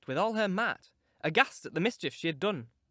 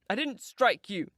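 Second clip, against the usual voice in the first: ordinary delivery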